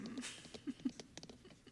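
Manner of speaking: high, soft trailing giggle; ASMR giggles. Real, not acted. Streamer to viewer who likes her giggles, soft giggling. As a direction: giggle softly, letting it fade out. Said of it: "You like the A S M R giggles?".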